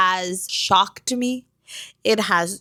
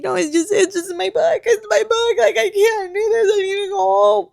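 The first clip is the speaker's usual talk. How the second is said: very high, whiny crying re-enactment; hammed-up tearful wail; mock sobbing; 11 semitones higher, 4 dB louder than usual. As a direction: blubber excuses in a squeaky, exaggerated cry. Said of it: "I am sobbing, sobbing in the middle of work".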